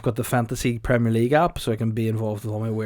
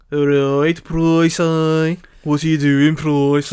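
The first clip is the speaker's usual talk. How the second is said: loud, hammed-up Brummie gangster; Tommy Shelby; 3 semitones higher, 7 dB louder, much slower than usual. Gangster greeting Pricey, loud mock Brummie drawl. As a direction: greet him in a broad mock Birmingham accent. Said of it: "I will be Tommy Shelby".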